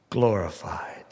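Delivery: dropped to a whisper; whispered climax. Acted. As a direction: breathe the last word out.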